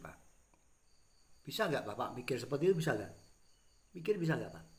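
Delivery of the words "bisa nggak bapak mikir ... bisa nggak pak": angry. Real, not acted. Angry rant at PLN officials.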